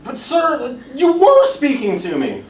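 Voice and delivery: very high, loud, squeaky insistence; comically indignant junior officer; Captain Yossarian, protesting. Acted. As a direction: plead your case in a strained high voice.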